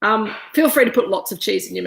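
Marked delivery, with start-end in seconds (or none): none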